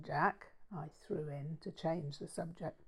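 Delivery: soft unvoiced aside; whispered aside. A narrator's reading. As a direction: breathe it like a private thought. Narrator's aside, inner thought.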